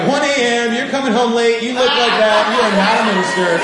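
hammed-up disbelief, voice pushed up; skeptical accusation. Doubting a late-night minister, mock accusing.

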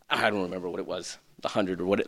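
low, soft, fast aside; quick aside. Real, not acted. Pastor aside to the congregation, soft and fast. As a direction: toss it off under the breath.

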